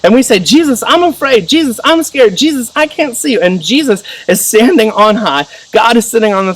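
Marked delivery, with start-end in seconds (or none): 0.4-3.4 put-on scared child's plea. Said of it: "we're going in this story".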